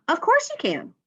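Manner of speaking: high-pitched glad exclamation; exclaiming. Acted. Someone answering a request to hold the baby, exclaiming. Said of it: "She exclaimed".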